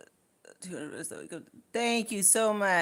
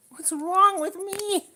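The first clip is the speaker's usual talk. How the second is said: mock despair; 9 dB louder, slower than usual. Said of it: "'cause I'm like".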